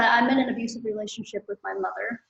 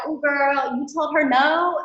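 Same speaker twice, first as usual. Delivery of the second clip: higher, louder, delighted disbelief; impressed therapist; 5 semitones higher, 5 dB louder, slower than usual. Retold or imitated. Therapist reacts to her saying no to mom, impressed. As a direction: cheer her on like a proud friend. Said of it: "my therapist's like".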